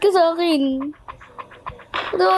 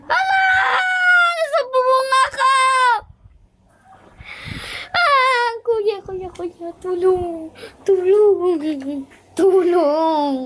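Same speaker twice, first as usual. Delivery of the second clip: high, frantic wail for help; panicked cry for help; 4 semitones higher than usual. Pretend. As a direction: cry out to big brother in mock panic. In-game panic crying for help.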